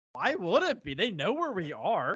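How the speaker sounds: high, laughing scoff at the question; laughing mockery. Space Wolf laughs at the Raven Guard's encryption worry.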